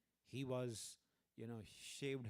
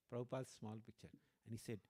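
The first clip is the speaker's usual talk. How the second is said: normal talking voice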